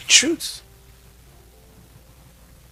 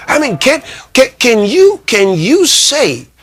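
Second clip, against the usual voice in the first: higher, repeated can, slowing on say; emphatic stammer; 8 semitones higher, 3 dB louder than usual. Real, not acted. Preacher challenging the listeners, stuttering with emphasis. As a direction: hammer the question home.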